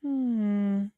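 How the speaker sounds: usual speaking voice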